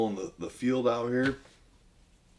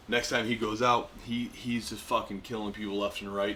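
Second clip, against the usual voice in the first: usual speaking voice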